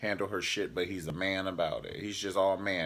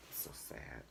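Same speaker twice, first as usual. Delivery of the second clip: whisper; 8 semitones lower, 17 dB quieter than usual. Real, not acted. Mock pity aside.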